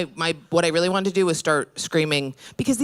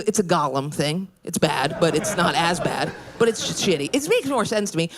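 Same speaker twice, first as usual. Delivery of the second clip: normal talking voice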